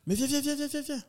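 very high, rapid-fire urging; eager beckoning. Retold or imitated. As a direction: imitate a director hustling someone inside. Director urgently beckons him in. (invitation pressante, voix aiguë)

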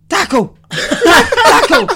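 loud mocking yell of a youth coach; mock shout. Youth coach yelling at his fullback, mock shouting. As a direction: bark it like a touchline coach, laughing. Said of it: "he's shouting to the fullback".

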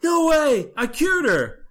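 slow, high stunned "no way"; hammed-up disbelief at bad news; mock disbelief. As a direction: drag out the denial in mock shock. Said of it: "It's like".

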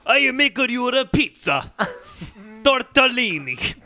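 hammed-up Italian accent, slow; a cheesy pizza-chef Italian accent; mock Italian accent. Joking about Rasputin's accent, hammy Italian. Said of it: "a hybrid between Russian and Italian".

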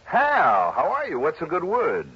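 bright, loud burst of recognition; hearty surprise. Acted. Johnny greets Hal on the phone with delighted surprise. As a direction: greet an old pal with delight.

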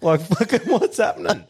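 can barely tell it for laughing. Real, not acted. Laughing while retelling confusion.

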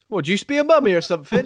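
ignorant local quizzing an Egyptian, high and fast; mock bigoted question. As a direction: rattle off a clueless question.

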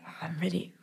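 1 whispered thought. Retold or imitated. Whispered excited inner thought.

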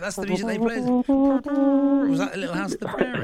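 high mock voice. Mocking the hummed tune.

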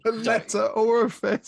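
high, loud, drawn-out incredulous echo; amused mockery. Real, not acted. Podcaster teasing co-host over his word for letterbox, mocking. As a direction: repeat it slowly in gleeful disbelief. Mockingly echoes co-host's odd term.

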